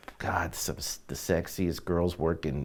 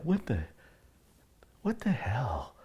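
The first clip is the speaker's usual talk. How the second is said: stunned whisper; 5 semitones higher, 7 dB quieter than usual. Retold or imitated. Stunned by the new record.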